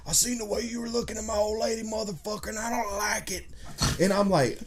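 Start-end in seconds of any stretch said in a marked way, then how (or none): 0.1-3.4 high, hushed mock tough-guy threat; mock-menacing old dude; old man threatening. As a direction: quote the jealous old man in a squeaky, menacing hush. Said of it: "be like".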